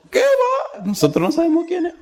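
very high, giggling dismissive voice; laughing employee. Retold or imitated. Hotel employees laughing off the unknown pastor, high and giddy. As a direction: squeal it out like a gossiping worker.

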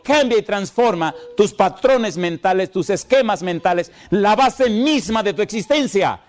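a preacher proclaiming to his congregation, high and fervent; fiery preaching. Acted. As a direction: preach it with full fire, building. Preaching at full force.

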